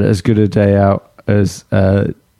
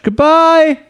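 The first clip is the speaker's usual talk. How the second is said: high sign-off. Real, not acted. Podcast sign-off.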